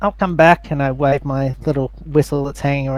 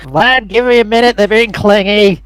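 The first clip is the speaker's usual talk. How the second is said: raised, high call across to Vlad; yelling out; 6 semitones higher, 7 dB louder than usual. Acted. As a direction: call out loudly for a moment's patience. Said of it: "I just yell out".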